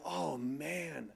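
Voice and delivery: soft, sinking realisation; heavy-hearted. Retold or imitated. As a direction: sigh it out quietly under conviction. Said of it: "was just heavy on my heart".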